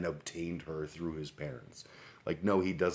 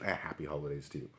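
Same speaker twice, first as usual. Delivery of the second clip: usual speaking voice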